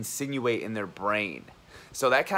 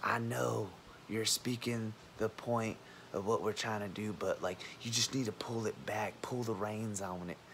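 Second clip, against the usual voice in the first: mock calm coworker; 7 dB quieter than usual. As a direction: quietly imitate the guy telling you to pull it back. Retold coworker telling him off.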